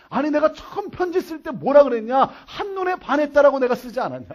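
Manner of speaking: loud, high-pitched acted protest; heated comeback in a quarrel; exasperated retort. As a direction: shout back in your own defence. Man shouts back in acted quarrel.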